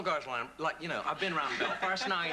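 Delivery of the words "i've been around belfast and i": put-on Northern Irish accent. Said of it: "if I try to do a Northern Irish accent".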